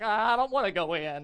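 loud, hammed-up balking; mock reluctance. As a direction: act out an anxious introvert digging in. Acts reluctant introverts resisting getting involved.

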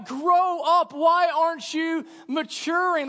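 high, loud fed-up outburst; mock yell. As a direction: fire it at him, fed up. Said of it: "that's the question that's running through my mind".

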